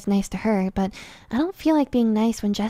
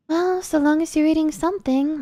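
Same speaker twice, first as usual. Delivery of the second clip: higher, unconvinced mother voice; doubtful mother; 7 semitones higher than usual. A narrator's reading. Mother doubts her daughter is eating, in a higher doubtful voice. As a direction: say it as if you don't believe it. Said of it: "but she sounds doubtful".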